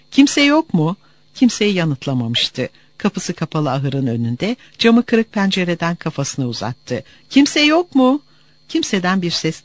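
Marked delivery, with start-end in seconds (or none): 0.1-0.9 loud call into the empty farm. A narrator's reading. 7.3-8.2 louder, higher call through the window. A narrator's reading.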